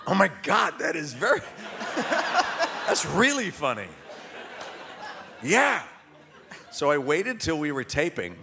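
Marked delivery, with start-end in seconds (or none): 0.1-5.8 higher-pitched, laughing approval; tickled, acting out being won over by the idea; delighted reaction. Actor telling the electrician his gag is really funny, delighted.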